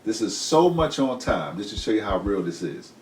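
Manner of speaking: ordinary talk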